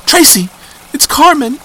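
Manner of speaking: high, loud frantic sobbing pleas; blubbering panic. Acted. Terrified girl begging her friend on the phone, frantic sobbing. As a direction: babble in terror.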